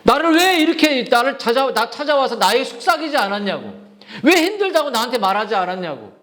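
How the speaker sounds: imploring why-questions, pitched high; aching regret. Acted. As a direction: act out God's heartache over being left out. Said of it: "그거를 안타까워하시지".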